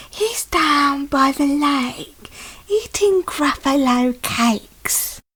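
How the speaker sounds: slow drawn-out snake voice with a chuckle; sly snake. Acted. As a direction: tease like a sneaky snake. Snake points away.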